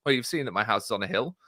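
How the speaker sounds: put-on light Scottish accent, broad vowels on doon and oot; bit of Scottish accent. Podcast host quoting the villain from the trailer, in a bit of Scottish accent. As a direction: imitate the film's villain with a slight Scottish lilt. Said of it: "he does a bit of a Scottish accent".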